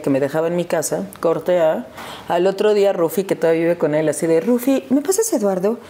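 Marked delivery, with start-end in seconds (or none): 4.4-5.8 acted phone request. Re-enacts phoning to find her husband.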